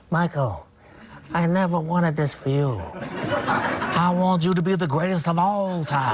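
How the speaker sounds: a light Godfather-style rasp on Ali's words; half-Brando, half-Ali, just lightly voiced; the Ali bit that started as a hint of Brando. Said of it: "it came out like".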